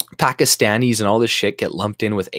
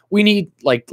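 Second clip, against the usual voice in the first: higher, louder mock pundit opening; Don Lemon; 10 semitones higher, 4 dB louder than usual. Mocking a TV host calling for bans, hammed-up. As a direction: launch into a TV pundit parody. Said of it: "what's his, Don Lemon?".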